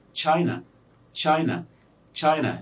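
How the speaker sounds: China with a strong first syllable, falling on the second; stress on first syllable. Shown as a demonstration. Teacher showing students which syllable is stressed, first-syllable stress in China. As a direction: lean on the opening of China, let its ending drop. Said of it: "the first syllable here is stressed".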